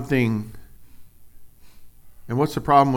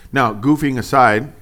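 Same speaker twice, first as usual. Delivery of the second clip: ordinary delivery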